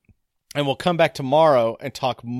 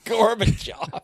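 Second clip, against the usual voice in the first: drawn-out, higher-pitched echo of a name; playful echo; 8 semitones higher, slower than usual. Pretend. Co-host echoing the suggested famous name, playful drawl. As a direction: stretch out the name on a high pitch.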